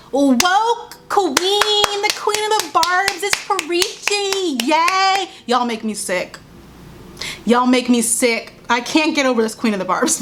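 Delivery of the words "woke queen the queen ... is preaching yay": high, drawn-out gushing cheer; sarcastic fan adulation; mock fawning praise. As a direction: mockingly cheer her on, stretching the words out high. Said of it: "She says it".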